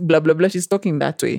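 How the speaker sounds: usual speaking voice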